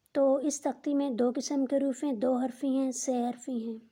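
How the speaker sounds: ordinary talk, nothing marked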